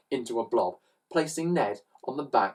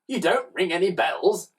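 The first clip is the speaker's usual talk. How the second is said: plain reading voice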